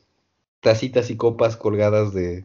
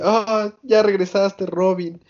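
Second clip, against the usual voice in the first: higher, sweet welcoming voice; lightly voiced fawning cook; doting Sanji; 7 semitones higher than usual. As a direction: greet her like an eager host. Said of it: "le dice".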